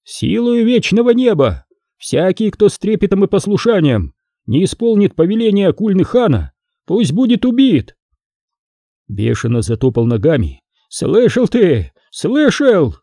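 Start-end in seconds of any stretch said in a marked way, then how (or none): 0.0-8.0 higher, ceremonious proclamation, not shouted; solemn reading. Acted. Envoy reading the khan's decree aloud to the court, solemn. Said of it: "Прочёл без крика, торжественно". 10.9-12.9 high, slow, stamping shout; raging demand. Acted. Stamping envoy demanding the priest heard it, raging.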